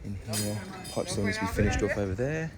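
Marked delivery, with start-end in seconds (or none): none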